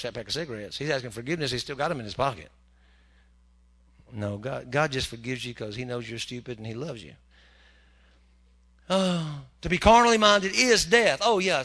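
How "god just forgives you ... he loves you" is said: ordinary talk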